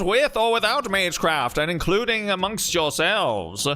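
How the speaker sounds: put-on booming old newsreel announcer; old-timey radio announcer. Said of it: "give him the old-timey radio announcer voice".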